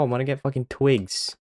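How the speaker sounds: usual speaking voice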